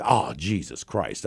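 low, slow, deflated mutter; dismayed groan. Retold or imitated. Punter told to kick soccer style, low slow groaning dismay. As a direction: sink into dread under your breath. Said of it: "I'm like".

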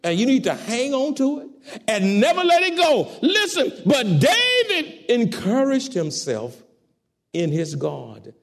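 high, loud, fiery preacher cry; preaching at full force. Acted. Preacher stirring the congregation, loud and rising. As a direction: preach it out to the church.

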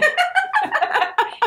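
cracking up at the joke; burst of laughter. Real, not acted. Laughing hard at the partner's joke.

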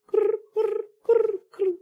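short, high-pitched trilled noises; high rolled "crr" noise. Retold or imitated. Man filling a tense silence in his story, high trilled noise. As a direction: imitate a repeated high crackling trill.

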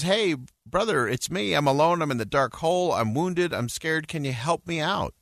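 high-pitched plea, lightly dramatized; mild calling out. A narrator's reading. Trapped man begs his brother, mild calling out. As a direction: call up to your brother for help, no real shouting. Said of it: "and he shouts up and he says".

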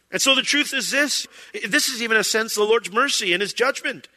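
normal talking voice

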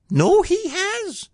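very high, slow little squeak; Piglet voice. A narrator's reading. Piglet agrees about poor Eeyore, squeaking.

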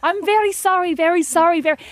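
hammed-up Italian accent; Italian accent retry. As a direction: push the Italian accent harder. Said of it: "that wasn't very Italian".

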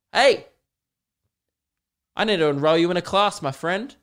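mock cheery parent, fast; overeager new parent. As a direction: greet the new teen a bit too keenly. Adoptive parent greeting teen.

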